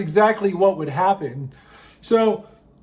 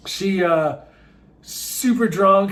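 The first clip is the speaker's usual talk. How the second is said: ordinary talk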